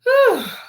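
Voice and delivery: loud, high sigh of frustration; fed-up sigh. Real, not acted. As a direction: sigh hard like you've had enough. Fed up with the stepmother.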